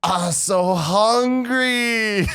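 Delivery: high, drawn-out goofy voice; wobbly high stoner whine; stoned guy's line. Retold or imitated. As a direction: stretch it out like a giggly stoned kid. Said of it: "he goes".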